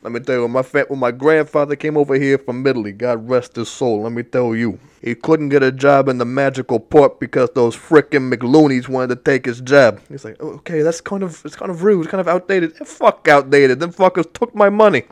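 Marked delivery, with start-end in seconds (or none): 0.0-10.0 loud, hammed-up Jersey Italian rant; Italian American guy. Italian wizard complaining about his grandfather's job, loud wiseguy rant. 10.4-12.7 meek objection. Retold or imitated. 12.8-15.0 loud, high wiseguy outburst; Italian guy snapping back. Acted. Italian wizard shouting down the objection, loud wiseguy outburst.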